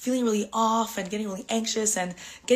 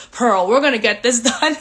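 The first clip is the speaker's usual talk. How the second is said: mirror pep talk; 3 semitones higher, 9 dB louder than usual. Retold or imitated. To herself in the mirror, a loud laughing pep talk. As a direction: psych yourself up in the mirror. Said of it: "in the mirror".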